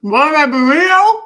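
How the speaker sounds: high loud protest; defensive outburst with mouth full; indignant surprise. Real, not acted.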